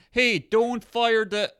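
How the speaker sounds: high, loud, drawn-out warning call; a hammed-up shout to stop the launch; urgent mock shout. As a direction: call out to stop them, slowly. Acted urgent message, loud.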